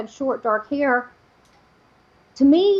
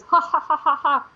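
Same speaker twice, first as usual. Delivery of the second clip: imitated laughter, pitched higher; mock demon laugh; 4 semitones higher than usual. Demon laughing at the praying woman, mock laughter. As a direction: act out a demon's spaced-out ha-ha. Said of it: "reared back and started laughing".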